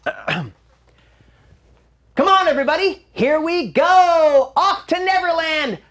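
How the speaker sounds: put-on high, bright boyish hero call; Peter Pan audition voice. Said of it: "they all looked like Peter Pan".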